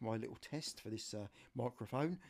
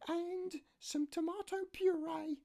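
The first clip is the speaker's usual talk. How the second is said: much higher falsetto voice for his niece; niece's voice. Retold or imitated. Niece phoning her uncle for help, high falsetto. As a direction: play the niece asking a favour, pitched way up. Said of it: "no, got it wrong".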